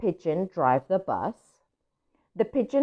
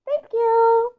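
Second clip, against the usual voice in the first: much higher, louder duckling thanks; tiny duckling voice; 4 dB louder than usual. Acted. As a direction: chirp a grateful thank you.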